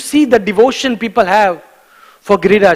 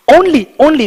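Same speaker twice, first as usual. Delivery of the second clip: only said high and louder; stress on only; 6 semitones higher, 4 dB louder than usual. Shown as a demonstration. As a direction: lean hard on only. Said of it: "this word only".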